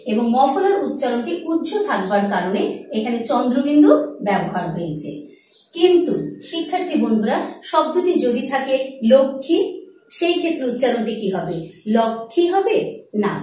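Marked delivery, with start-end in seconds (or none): none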